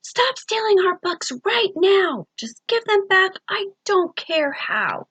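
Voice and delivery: high, slow and forceful shout out the window. A narrator's reading. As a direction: yell it into the night, stern and slow. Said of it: "shouted the, to the thing outside".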